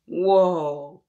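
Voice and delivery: loud wondering whoa; amazed whoa. Acted. Host reacting to the title for children, loud amazed. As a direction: sell the wonder to the kids.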